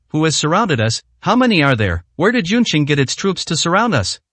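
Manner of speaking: plain reading voice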